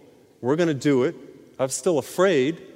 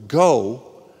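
"go" said louder as a single word; stressed "go"; 7 dB louder than usual. Shown as a demonstration. Preacher singling out Jesus' word to the healed man, stressed. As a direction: hit "go" as the key word. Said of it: "here's a word".

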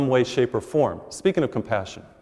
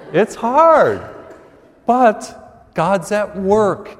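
much higher and louder, crying out "It's hard"; emphatic preaching; 7 semitones higher, 6 dB louder, much slower than usual. Acted. Preacher telling the congregation life is hard, crying it out. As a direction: belt out "It's hard" like a cry. Sermon on life's hardships.

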